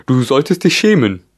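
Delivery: high, loud scolding creature; scolding gryphon. A narrator's reading. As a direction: chide her sharply. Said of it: "fügte der Löwengreif hinzu". (scheltender Greif, hoch und laut)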